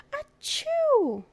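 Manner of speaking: loud, high mock sneeze. Amos waking up with a big sneeze. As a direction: burst out a big fake sneeze.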